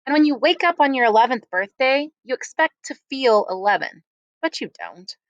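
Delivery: plain reading voice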